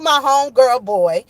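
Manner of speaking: usual speaking voice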